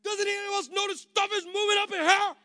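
high, loud, frantic call; panicked yell. Retold or imitated. Panicked guest calling out to his hosts, loud and high. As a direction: holler it in alarm. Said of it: "I yell for the pastor".

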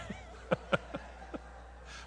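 soft, wheezy laughter; real laugh. Real, not acted. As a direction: let a quiet laugh run on. Laughs after his own singing bit.

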